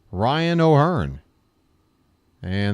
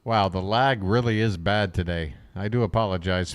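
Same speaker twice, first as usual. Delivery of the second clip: ordinary talk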